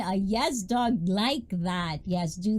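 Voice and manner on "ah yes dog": normal talking voice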